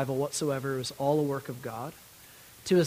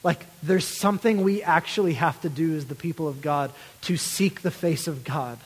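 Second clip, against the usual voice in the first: normal talking voice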